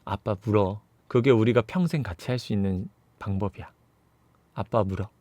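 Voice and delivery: low, hushed father's voice; subdued acted plea; quiet plea. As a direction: softly persuade your daughter, pitched low. Father quietly begs daughter to bite.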